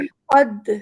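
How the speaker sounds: loud qalqalah demo; qalqalah on dal. Shown as a demonstration. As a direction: hit the dal and let it echo. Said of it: "دال پہ قلقلہ ہو رہا ہے".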